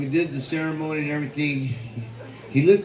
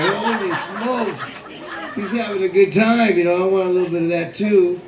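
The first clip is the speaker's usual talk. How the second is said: amused; 6 semitones higher than usual. Retold or imitated. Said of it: "I was like".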